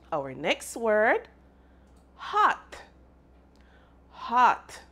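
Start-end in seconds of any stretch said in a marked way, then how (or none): 2.1-2.8 "hot" lifted much higher, sounds drawn out clearly; exaggerated sounding out. Shown as a demonstration. Teacher modelling "hot" for children to copy, exaggerated. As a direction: model "hot" boldly for kids to repeat. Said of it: "Say it after me".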